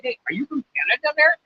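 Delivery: high, quick Minnesota-style question; imitating Minnesota locals. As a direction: lightly mimic a Midwest local asking. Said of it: "they say".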